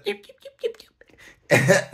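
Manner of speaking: high little yips like a tiny dog; mock yapping. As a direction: yap it out like a small nagging voice. Mimicking the mind's chatter.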